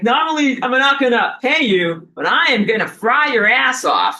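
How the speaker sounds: mock sun gloat. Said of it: "It's like".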